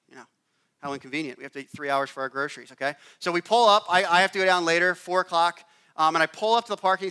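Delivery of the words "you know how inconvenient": soft, sighing mock complaint; muttered sarcasm; sarcastic sigh. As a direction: roll your eyes at the delay.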